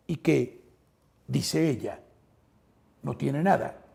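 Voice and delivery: usual speaking voice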